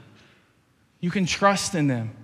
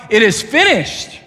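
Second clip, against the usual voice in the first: raised preacher's cry, falling at the end; quoted yell; 7 semitones higher, 8 dB louder than usual. Retold or imitated. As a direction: proclaim it loudly as a quoted cry. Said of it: "He yells out".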